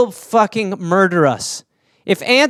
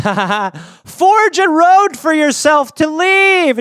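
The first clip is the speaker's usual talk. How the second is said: slow, higher gloating voice; mock taunting; 8 semitones higher, slower than usual. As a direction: taunt her slowly, a hint of cartoon villain. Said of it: "you're like".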